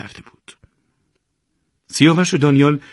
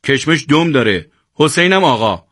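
irritated, higher-pitched correction; testy, raised-voice reproof; growled rebuke; 6 semitones higher, 5 dB louder than usual. A narrator's reading. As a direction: snap back with a glare. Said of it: "چشم قره رفت و قرید".

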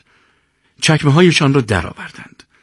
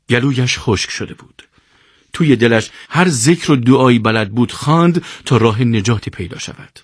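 plain reading voice

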